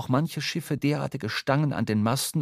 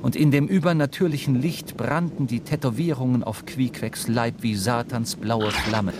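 normal talking voice

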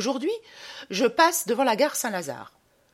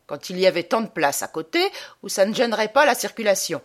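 ordinary talk

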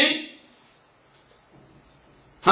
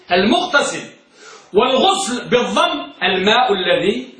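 usual speaking voice

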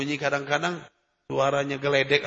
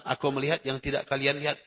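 same line softer and lower, almost confiding; quiet retelling; 4 semitones lower, 6 dB quieter than usual. Shown as a demonstration. Preacher repeats the Prophet's words softly to listeners, confiding. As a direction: drop the voice and let it sink in. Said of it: "Rasulullah menyatakan".

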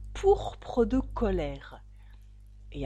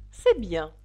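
loud, curt royal approval; shouted; 8 dB louder than usual. A narrator's reading. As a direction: call out approval loudly. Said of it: "cria la reine". (approbation criée)

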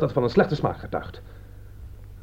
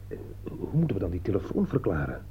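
hushed wondering; 2 semitones lower, 7 dB quieter than usual. Acted. Puzzling aloud over the phone calls.

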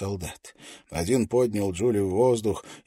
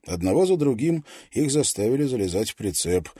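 ordinary delivery

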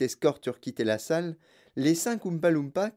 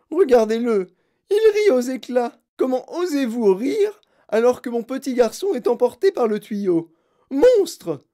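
high, loud furious accusation; howling outrage; 10 semitones higher, 8 dB louder than usual. A narrator's reading. Mother attacks laughing Wonka, howling. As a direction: scream at him, peaking on the last word. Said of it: "hurla-t-elle".

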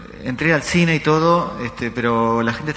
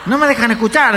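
high, loud protest; acted annoyed moviegoer; exasperated complaint; 10 semitones higher, 6 dB louder than usual. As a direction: complain over the din. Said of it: "dijo otro".

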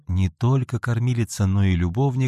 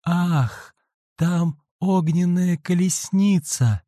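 frightened cry; 7 semitones higher, slower than usual. Acted. Dying girl sees fiery chariot.

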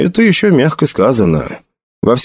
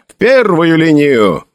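higher, drawn-out order; shouted command; 5 semitones higher, slower than usual. A narrator's reading. Felix orders musketeers to the front, shouting. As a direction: finish the order with a carrying call. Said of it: "крикнул Феликс".

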